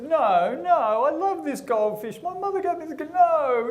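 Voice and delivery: high-pitched mock wail of denial; hammed-up grief, voice climbing; distraught owner. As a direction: wail in comic disbelief at bad news. Acted owner mourns dead goldfish.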